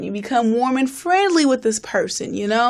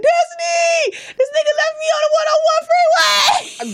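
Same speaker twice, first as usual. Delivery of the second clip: panicked call. Retold or imitated. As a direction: yell for help down the phone. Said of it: "I call Destiny, like".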